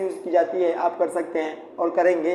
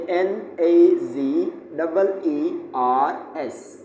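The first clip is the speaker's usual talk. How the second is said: slow spelling; 2 semitones lower, much slower than usual. Shown as a demonstration. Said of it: "हम यहाँ पर लिखेंगे".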